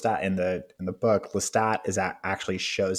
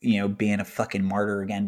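ordinary talk, nothing marked

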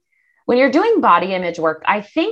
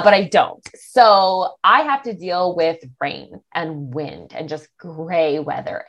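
ordinary talk, nothing marked

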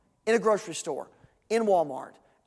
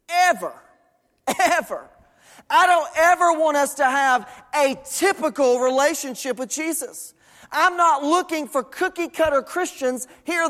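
higher, driving pulpit delivery; forceful preaching; 6 semitones higher, 3 dB louder than usual. Acted. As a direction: punch key words with conviction, preaching to the congregation.

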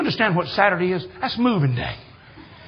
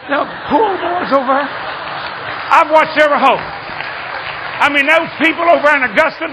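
high, loud pulpit proclamation; full-force preaching; 8 semitones higher, 8 dB louder than usual. Real, not acted. Preacher tells how God washed out the tournament, full-force preaching. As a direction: belt it out high to the whole congregation.

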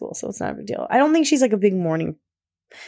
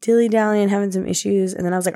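ordinary delivery

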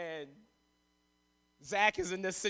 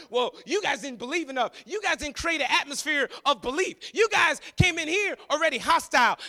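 indignant disciples; 5 semitones higher, 5 dB louder than usual. Acted. Disciples' angry blaming.

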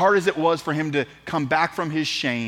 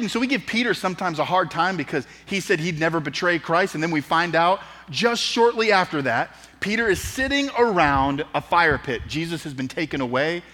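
usual speaking voice

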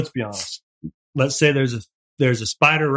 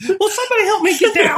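very high-pitched imitation of grandma; squeaky, hammed-up cry for help; mock grandma plea. Little grandma stuck on the refrigerator, high mock plea to be lifted off.

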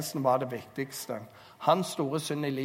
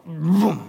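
a loud mock crash; a loud imitated boom; loud crash noise; 2 semitones higher, 11 dB louder than usual. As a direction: smack out the sound of collapse. Samson pulls the pillars down.

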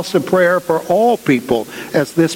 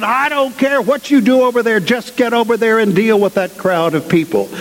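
higher, pressing voice of the rector; rector's urgent order; 6 semitones higher than usual. Retold or imitated. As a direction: hand out orders to the staff, pitched up. Rector orders them to the crowd.

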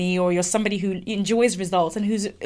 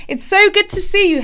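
much higher, louder effusive welcome; gushing greeting; 10 semitones higher, 5 dB louder than usual. Retold or imitated. Colleague welcomes her for their session, gushing. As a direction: imitate a colleague delighted at a visitor.

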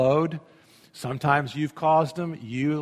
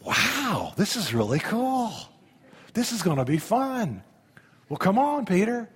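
higher, delighted casual voice; enjoy the moment, grinning; Jesus having fun with Peter; amused Jesus; 5 semitones higher than usual. Acted. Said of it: "I think Jesus is like".

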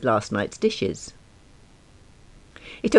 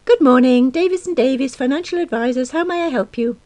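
high, bright telephone manner; phone receptionist voice; 13 semitones higher, 4 dB louder than usual. A narrator's reading. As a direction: put on the sing-song receptionist voice. Answering office phone.

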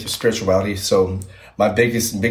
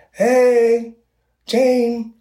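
high, far-off call of a name; mock call from upstairs; 4 dB louder than usual. Retelling the voice upstairs, a high mock call.

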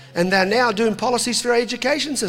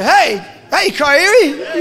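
loud, high teasing call between mates; 6 semitones higher, 8 dB louder, much slower than usual. Retold or imitated. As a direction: call out to a mate across the room, laughing. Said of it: "picking on him".

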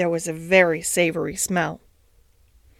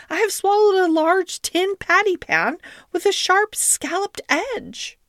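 very high, woeful whine; whining; 12 semitones higher than usual. A narrator's reading. Duchess describing the swallowed patty pan, whining. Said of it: "Duchess moaned and whined".

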